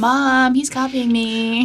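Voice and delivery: put-on high whiny kid tattling to mom; whiny tattling little sibling. S1 tattles on S0 copying.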